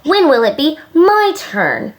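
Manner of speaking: put-on high, impatient child's complaint; pouting. A narrator's reading. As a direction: whine it with a pout. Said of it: "Jenny pouted".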